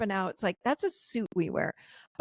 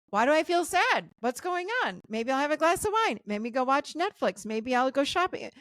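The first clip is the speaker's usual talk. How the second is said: higher, restless self-questioning; anxious inner voice; 6 semitones higher than usual. Pretend. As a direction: voice the fretful mind hunting for distractions, pitched up. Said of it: "do you stop it and go".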